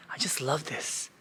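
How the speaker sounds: soft awed whisper. Real, not acted. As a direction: hush it like in a temple. Quiet awe inside a shrine.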